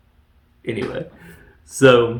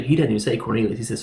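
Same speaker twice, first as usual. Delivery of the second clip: ordinary talk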